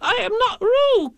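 very high, loud squeaky protest; high squeaky animal voice, loud; loud Piglet. A narrator's reading. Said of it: "said Piglet loudly".

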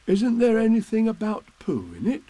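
a hint of an eager teddy bear; lightly voiced Pooh. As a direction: ask it as a hopeful little bear.

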